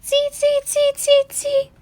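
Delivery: very high monkey squeaks; chattering monkeys. A narrator's reading. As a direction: squeak back in a tiny monkey voice. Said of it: "back at him and said".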